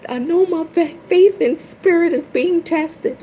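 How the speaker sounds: very high, loud, breaking plea; tearful mock-wailing plea to spirit; pleading cry. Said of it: "And then I was like".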